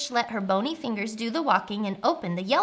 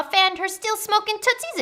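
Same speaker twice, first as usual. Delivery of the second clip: plain reading voice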